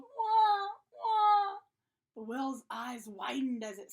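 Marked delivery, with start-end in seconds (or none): 0.0-1.6 two long, loud, high wails; repeated high "waaah"; yelled baby wails. A narrator's reading. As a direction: bawl twice at full voice like a scared baby. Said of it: "Finn yelled".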